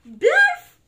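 very loud, high burst; nonsense outburst. Acted. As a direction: blurt the silly word at full volume. Book makes reader say nonsense.

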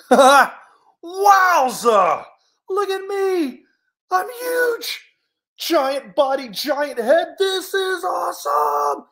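high, loud whooping delight; ecstatic excitement. Acted. Bear discovering his giant body, ecstatic whooping. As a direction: crow with joy, stretching the last words. Bear thrilled at being huge.

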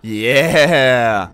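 loud drawn-out falling yeah; triumphant yeah. Real, not acted. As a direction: cheer in triumph. Celebrating vote result.

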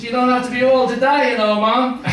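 high, emphatic mock-Jamaican voice; Jamaican accent. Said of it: "this Jamaican bar".

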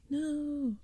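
high, drawn-out cry of alarm; high narrated cry, not full volume. A narrator's reading. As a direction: cry out in horror, pitched high. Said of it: "I cried out".

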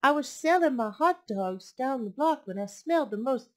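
lively storybook read-aloud; picture book read to a class; reading to children. A narrator's reading. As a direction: read it warmly to little listeners.

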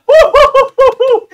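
very high, loud whoops breaking into laughter; high whooping laugh. Real, not acted. Gamer celebrating the winning hit, high whooping laughter. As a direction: whoop wildly, then cackle.

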